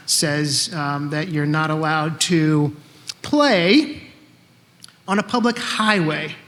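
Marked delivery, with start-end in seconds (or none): 3.2-4.0 "play" lifted higher and held; stress on play. Shown as a demonstration. Reading the law aloud, stress on play. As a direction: lean on "play" as the keyword.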